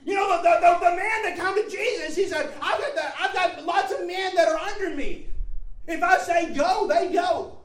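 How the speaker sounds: high, loud, driving sermon delivery; impassioned pulpit shout; full-force preaching. Real, not acted. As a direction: preach it out at the top of your range.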